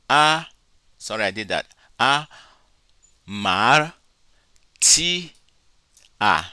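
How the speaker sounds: "A, mar, ti, a" spaced out, each syllable loud and separate; syllabified aloud. Shown as a demonstration. Teacher sounds out each syllable for the class, spaced apart. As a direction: break "A, mar, ti, a" into clear separate beats. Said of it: "because ro and tau cannot be pronounced together".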